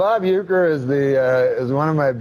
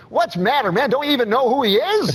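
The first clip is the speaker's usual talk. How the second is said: put-on high, indignant old ballplayer voice; mad Uecker impression; 10 semitones higher than usual. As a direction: act offended like a folksy announcer. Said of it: "He got all mad".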